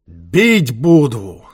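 loud, slow, drawn-out threat; shouted threat. A narrator's reading. Beaten gang leader straightens up, shouts threat. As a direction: hurl it back with defiance.

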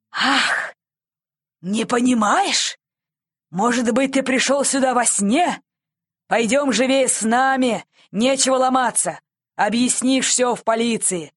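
loud, impatient, mocking reproach; scoff, then order briskly along; scornful scolding. A narrator's reading. Policeman marching the boy to the station, scornfully.

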